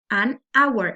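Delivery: "hour" starting straight on the vowel; silent H. Shown as a demonstration. Teacher repeating the example, "hour" said with silent H. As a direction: link "an" into "hour" with no H. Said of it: "la H no se pronuncia".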